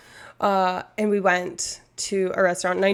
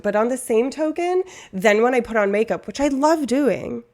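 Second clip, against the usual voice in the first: normal talking voice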